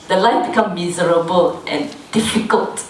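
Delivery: ordinary delivery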